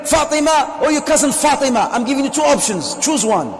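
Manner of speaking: high, loud commanding father; stern father ordering. Retold or imitated. Father giving his son an ultimatum, loud and commanding. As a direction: lay down the law to your son, no arguing.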